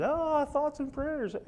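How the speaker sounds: high, slow, drawn-out sarcastic sigh; mocking quote. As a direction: stretch it out way up high in mock piety. Mocking online prayer posts.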